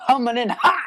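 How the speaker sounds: loud, high heckle; jeering shout. Real, not acted. Co-host heckling a bold pick, jeering. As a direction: holler it over the talk.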